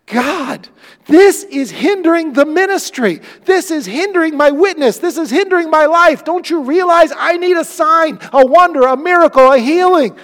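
loud, high, desperate cry to God; desperate shout. Acted. Preacher voicing Paul begging God, desperate shout. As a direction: shout at heaven, demanding an answer.

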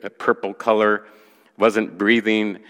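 ordinary talk

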